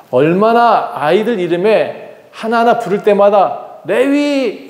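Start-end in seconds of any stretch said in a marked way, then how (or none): 3.8-4.7 high, drawn-out name call; calling a child. Acted. Mother calls her son by name, high calling voice. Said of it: "이렇게 부를 때마다".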